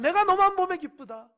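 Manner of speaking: 너만 stressed high and loud with a falling end; joyful exclamation. Acted. God telling a beloved child of joy, loud. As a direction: cry 내가 너만 보면 기쁘다 out in bright delight. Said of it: "하나님 볼 때에".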